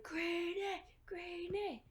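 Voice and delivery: high-pitched chant, shouted without the volume; mock crowd shout. Crowd urges the newcomer to fight, mock shouted chant. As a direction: imitate a crowd chanting a name, high and held back. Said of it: "start shouting".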